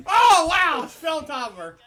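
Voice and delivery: very high, loud excited outburst; real fan shouting at a big spot. Real, not acted. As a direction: shout in delight at the move. Reacting to big wrestling move.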